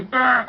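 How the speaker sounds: mock puppet growl; playful growl. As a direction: growl before biting.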